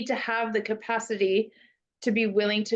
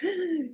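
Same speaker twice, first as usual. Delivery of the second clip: gasp; 9 semitones higher than usual. Retold or imitated. Student catching themselves mid-slip.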